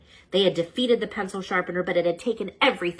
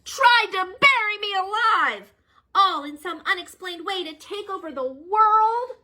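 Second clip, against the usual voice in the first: much higher, slow indignant list; rising outrage; 13 semitones higher, 4 dB louder than usual. Acted. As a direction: build the charges to a peak. Listing villain crimes.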